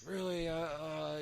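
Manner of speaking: high, drawn-out stressed reaction. Retold or imitated. Girlfriend nervous about the weed at the crossing, stressed out. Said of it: "she was stressed out".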